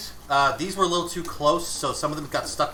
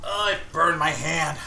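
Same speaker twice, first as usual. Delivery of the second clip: normal talking voice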